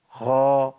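a voiceless خا in place of the ghayn; wrong kha. Shown as a demonstration. Teacher contrasting kha with ghayn for students. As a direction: breathe out a rough خا. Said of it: "لا، ده الخطأ".

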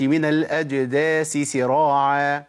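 الأجداث said with s instead of th; نطق خاطئ بالسين; wrong reading. Shown as a demonstration. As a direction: show the mistake الأجداس سراعا. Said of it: "ما أقولش".

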